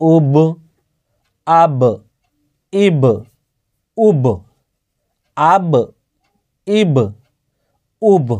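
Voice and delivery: উব, আব, ইব said slowly, each final ব released with a short bouncing echo; tajweed teacher bouncing the closing ব of আব; qalqalah bounce. Shown as a demonstration. Teacher drills students on qalqalah, each ব bounced with an echo. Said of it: "কলকলার মধ্যে যে পাঁচটি অক্ষর".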